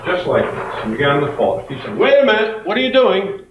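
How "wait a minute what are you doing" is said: teacher suddenly sees the rules bent; alarmed teacher. Retold or imitated. Teacher catching Karen folding the paper, startled.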